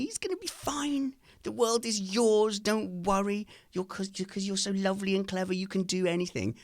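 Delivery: hammed-up parental cooing; doting comforting parent. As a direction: soothe a child with syrupy, high-pitched fussing. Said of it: "like, hug her and say".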